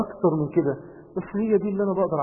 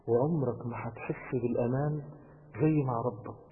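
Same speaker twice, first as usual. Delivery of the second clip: usual speaking voice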